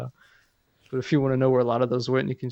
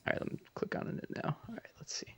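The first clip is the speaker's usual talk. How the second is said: hushed, barely voiced murmur; very soft, absent-minded self-talk; muttering to self; 9 semitones higher, 14 dB quieter, faster than usual. Real, not acted. Co-host clicking on the video link, muttering to self.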